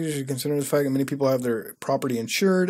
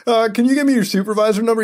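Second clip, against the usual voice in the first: mock caller; 7 semitones higher, 6 dB louder, faster than usual. Acted caller asks.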